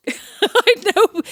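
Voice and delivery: slow, high, laughing through it. Real, not acted. Sister laughing about no siblings. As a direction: laugh as you say it. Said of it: "laughing mid-sentence".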